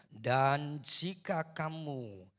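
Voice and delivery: full-force congregational scripture reading; loud reading aloud. Real, not acted. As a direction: declaim the verse to the whole hall. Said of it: "yuk baca, satu, dua, tiga".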